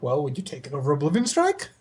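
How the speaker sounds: put-on weird voice, swooping up at the end. Player mimicking everyone's question about a good card, weird tone of voice. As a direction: imitate people asking it in an odd, rising voice. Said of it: "like, weird tone of voice".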